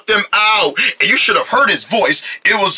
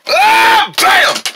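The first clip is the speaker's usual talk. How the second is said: surprise shout; 4 dB louder than usual. Acted.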